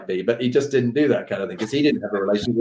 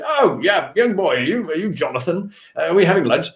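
higher-pitched impression of an elderly officer at lunch; General Desmond Gordon; 5 semitones higher than usual. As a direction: imitate a senior officer, pitched up. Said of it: "General Desmond Gordon said".

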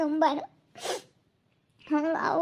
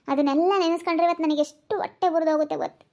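ordinary delivery

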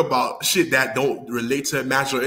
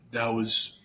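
ordinary delivery

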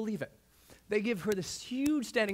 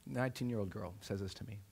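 ordinary talk, nothing marked

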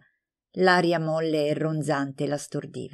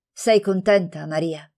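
plain reading voice

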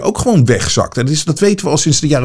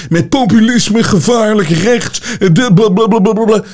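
much higher, sing-song mock outrage; mocking leftist pundit; 9 semitones higher than usual. Vlogger parodying leftist critics, higher mock sing-song. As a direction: parrot their slogans in a squeaky, dismissive voice.

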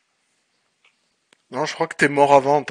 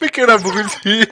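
exasperated; 7 semitones higher, 7 dB louder, slower than usual. Real, not acted. Frustrated outburst at the game.

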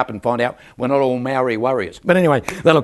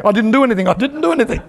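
high, laughing protest of innocence; mock innocent protest; 7 semitones higher, 4 dB louder, faster than usual. Preacher protesting to staring barbers, high laughing innocence.

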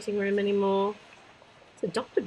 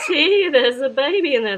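excited laugh; 6 semitones higher, 8 dB louder than usual. Real, not acted. Excited reveal of baby.

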